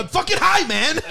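high-pitched, exclaimed excuse; defensive cry, pitch way up; pleading protest. Real, not acted. Excusing himself to annoyed co-host, high pleading protest. Protests when berated for missing bit.

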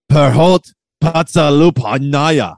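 put-on slow, loud, high hammy Russian accent; bad Russian accent. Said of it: "that's a pretty bad Russian accent".

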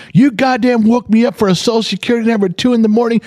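high-pitched indignant rant; exasperated, high-voiced reenactment of losing it; retold freak-out. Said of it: "I'm now freaking the fuck out".

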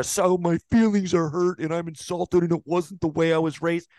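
mocking Diggs, high and whiny; mock hurt feelings. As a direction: sneer at his statement. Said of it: "Like a".

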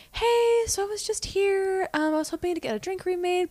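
high, soft, sugary customer voice; the customer asking the barista for a remade drink, sugary; sweet customer voice. Retold or imitated. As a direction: put on a falsely polite customer tone. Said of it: "she's like".

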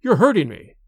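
put-on irritated old man in pain; rough complaint. A narrator's reading. Wounded father to his clumsy son, roughly complaining. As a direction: protest with a touch of anger. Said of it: "pushed Dorda away roughly".

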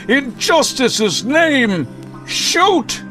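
high, drawn-out rallying cry; urgent heroic shout. Acted. Hero orders Nora to shoot, urgent shout. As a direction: cry it out, leaping up on the last word.

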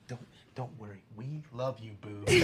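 hushed, very soft comforting murmur; mock consoling. Podcaster pretending to comfort insulted boobs, hushed mock consoling. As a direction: soothe very softly, in mock tenderness.

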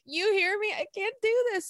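high, near-tears mock cry; mock overwhelmed outburst. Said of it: "I would be like".

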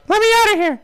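high squeaky trapped puppet; goofy muffled sock puppet. Acted. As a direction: squeak it like a stuck toy. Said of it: "You go up and press".

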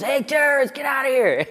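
high repeated heckler call; imitating a heckler again; mock yell. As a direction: yell it down from above. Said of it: "Someone goes".

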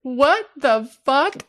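a slow, rising what the fuck; shocked what. Retold or imitated. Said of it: "And I'm like".

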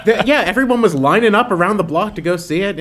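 high, mock-eager sarcasm; sarcastic. Mocking the film's pretend hype, sarcastic high voice. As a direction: oversell it sarcastically. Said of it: "it's like".

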